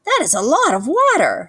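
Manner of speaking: a gosling amazed at the ocean; wide-eyed gosling. A narrator's reading. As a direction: gasp it out in wonder. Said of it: "said the wide-eyed gosling".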